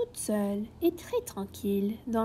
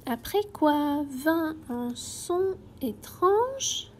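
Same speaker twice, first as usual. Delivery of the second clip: plain reading voice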